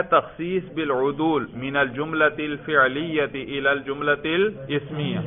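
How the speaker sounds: measured Arabic term recited; formal recitation. Shown as a demonstration. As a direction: recite the grammar rule like a textbook. Reads Arabic grammar term formally. (عربی اصطلاح کی رسمی قراءت)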